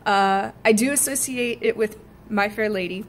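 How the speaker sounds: ordinary talk, nothing marked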